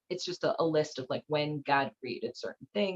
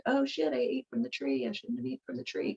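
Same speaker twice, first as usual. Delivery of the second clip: higher, softer guilty fretting; mock panic; 6 semitones higher, 3 dB quieter than usual. Adam and Eve regretting the fruit, mock panic. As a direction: fret under your breath, pitched up.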